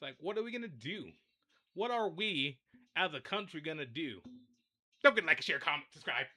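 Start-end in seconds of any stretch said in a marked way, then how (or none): none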